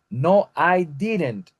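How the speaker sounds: slow model answer. Shown as a demonstration. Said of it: "teacher models short answer".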